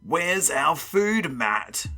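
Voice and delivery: slow, high creature demand; demanding monster. A narrator's reading. Big monster to Matt, demanding. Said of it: "the big one demanded".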